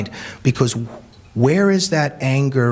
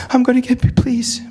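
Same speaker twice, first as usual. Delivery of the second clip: higher, urgent begging; pleading girl; 11 semitones higher than usual. Retold or imitated. Hijabi girl begs speaker again, high urgent plea. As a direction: beg once more. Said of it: "The hijabi girl".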